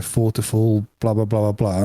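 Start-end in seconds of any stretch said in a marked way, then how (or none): none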